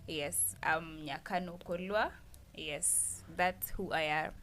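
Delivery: usual speaking voice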